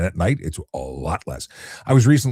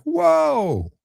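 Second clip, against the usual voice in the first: a sharp, much higher startled "whoa"; alarmed whoa. Retold or imitated. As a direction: react as a car suddenly rolls at you. Driver starts moving toward him.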